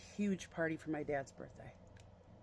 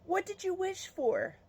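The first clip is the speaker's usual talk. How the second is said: much higher, put-on party guest voice; high mock-eager asking; obligatory party question; 12 semitones higher than usual. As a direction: imitate a guest's predictable question, pitched way up. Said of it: "somebody did the obligatory".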